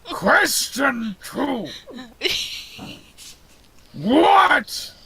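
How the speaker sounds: slow, high-pitched monster shout; labored, drawn-out creature quizmaster; Frankenstein's monster. Acted. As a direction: boom each word separately like a giant. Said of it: "giant behemoth of a corpse man".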